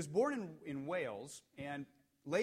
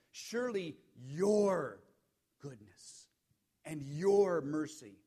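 both "your" drawn out and punched loud; "your" stretched and louder, "goodness" dropping away; stress on YOUR; 6 dB louder, much slower than usual. Shown as a demonstration. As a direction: slowly lean hard on each "your". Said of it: "is this".

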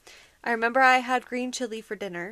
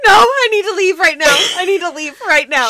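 higher, louder, laughing through tears; plead, between crying and panic; panicked crying; 6 semitones higher, 10 dB louder than usual. Retold or imitated. Refusing a ride home, crying in panic. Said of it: "that's exactly how she sounded".

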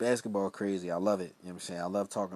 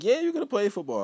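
higher, louder, breezy go-ahead; mock coach voice; 11 semitones higher, 8 dB louder than usual. Coaches finally telling him he can play, in a light mock voice. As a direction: toss off the news lightly. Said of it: "they like".

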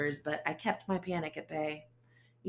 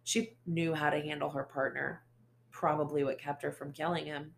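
usual speaking voice

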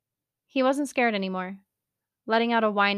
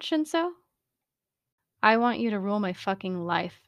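usual speaking voice

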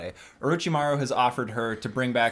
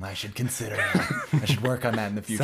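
mock earnest villain; 3 semitones lower than usual. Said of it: "he's like".

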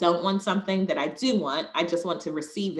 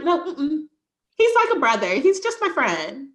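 much higher, loud airy denial; denying girl next door; 8 semitones higher, 3 dB louder than usual. Retold or imitated. Insisting a crush is only a friend, much higher girl-next-door denial. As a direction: squeal it off like he's only a pal. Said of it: "but I'm like".

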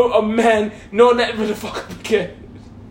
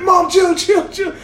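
panicked plea; 7 semitones higher than usual. Retold or imitated. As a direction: shout for her to stop. Son pleading under beating.

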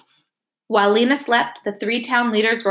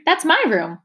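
put-on higher, indignant boy's cry; protesting boy; 8 semitones higher than usual. A narrator's reading. Boy protests to the doctor, higher and indignant. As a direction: claim the room as yours. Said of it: "cried Torrin".

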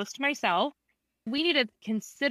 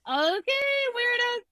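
weirded-out. Retold or imitated. Patient reacting to laughing therapist, mock weirded-out. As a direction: imitate someone humouring oddballs, pitched up.

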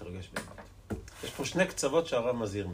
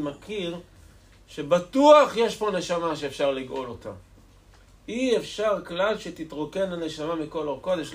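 raised, insistent rabbinic exhortation; fervent sermon pitched up high; impassioned preaching; 8 semitones higher, 9 dB louder than usual. Acted. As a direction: preach it higher, pressing each point home. Preaches with force.

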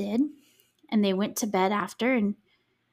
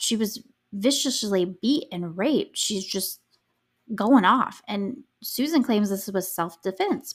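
ordinary talk, nothing marked

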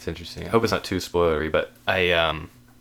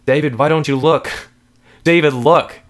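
raised, high, loud pleading demand; urgent demand; 10 semitones higher, 12 dB louder than usual. Acted. Wife urges her husband to look, loud demand. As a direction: cry out for his attention.

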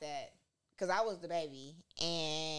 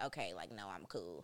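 lower, softer, laid-back brother voice; a hint of a cool older brother, lower and softer; imitating older brother; 11 semitones lower, 6 dB quieter than usual. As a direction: drop the voice low and shrug it off like her brother. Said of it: "he was like".

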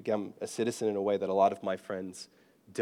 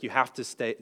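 usual speaking voice

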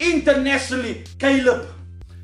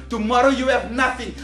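ordinary talk

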